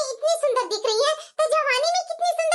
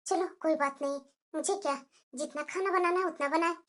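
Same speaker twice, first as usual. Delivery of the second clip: much softer and lower, shrugging it off; resigned mutter; 8 semitones lower, 9 dB quieter than usual. Acted. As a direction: let it go under your breath. Muttering alone in kitchen.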